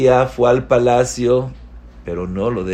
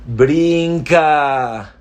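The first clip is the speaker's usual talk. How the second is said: higher, louder single urging word; urgent command; 6 semitones higher, 5 dB louder than usual. Real, not acted. Preacher urging the congregation to leap, loud and high. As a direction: call it out to the listeners.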